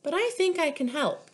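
put-on sweet and bright old lady. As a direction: chirp it brightly. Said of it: "she said brightly".

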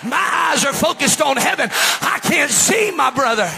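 high, impassioned shouted preaching; screaming preacher. Real, not acted. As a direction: shout over the congregation, pitched high and driving. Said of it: "with me screaming".

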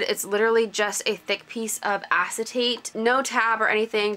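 normal talking voice

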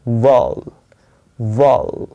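वाउल slowly as one long syllable; correct pronunciation. Shown as a demonstration. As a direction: model वाउल, repeated with a pause between. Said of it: "इसका जो correct उच्चारण ही है". (वाउल, धीरे और साफ़ सही उच्चारण)

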